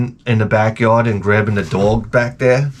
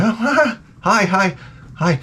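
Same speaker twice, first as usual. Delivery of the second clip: high, uneasy laugh into softer hellos; nervous greeting; 8 semitones higher, 3 dB quieter than usual. Acted. Comic to staring man across yard, nervous greeting.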